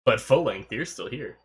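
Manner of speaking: ordinary delivery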